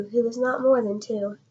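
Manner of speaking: ordinary delivery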